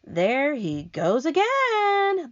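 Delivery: high, slow exasperated cry; a fed-up earthworm calling out; slow, high complaint about the centipede; earthworm cries out. Acted. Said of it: "the earthworm cried".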